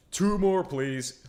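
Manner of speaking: high, loud plea, easing off; loud hurrying demand; urgent calling out. Pretend. Urges the machine to hurry.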